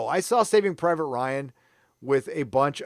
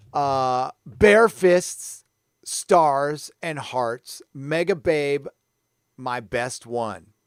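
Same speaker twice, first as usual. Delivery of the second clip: reads loud and slowish in a lightly put-on America Chavez accent; hammed-up America Chavez comic-reading accent, louder than his chat; 3 semitones higher, 7 dB louder, slower than usual. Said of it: "this is my America Chavez accent".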